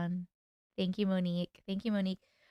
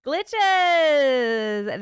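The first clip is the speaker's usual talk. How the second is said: shouted, louder greeting; loud call-out; 4 semitones higher, 9 dB louder than usual. Real, not acted. Host hailing her fans by nickname, shouted. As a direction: belt it out to the crowd. Calling out to viewers.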